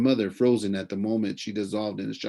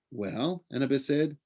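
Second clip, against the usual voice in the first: plain reading voice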